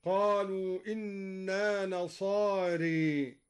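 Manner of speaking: very slow recitation, bend the last vowel of "نصاري" to e; "نصاري" ending as nasaaree, the aa after r tilted; imala. Shown as a demonstration. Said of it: "وأمال الألف بعد الراء".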